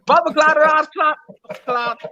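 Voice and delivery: put-on high, playful Jamaican accent; mock Jamaican exclamations. Demonstrating Jamaican slang.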